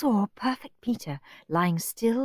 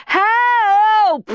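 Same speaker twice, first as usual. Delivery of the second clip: high loud cry for help; shouted; 10 dB louder than usual. A narrator's reading. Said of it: "shouted Horrid Henry".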